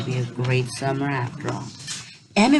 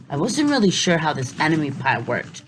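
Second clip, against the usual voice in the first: plain reading voice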